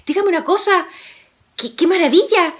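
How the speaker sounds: asombrado, más agudo; voz del admirador. A narrator's reading. Viajero admira el perro. (Juan admira el perro del viajero, voz aguda y asombrada)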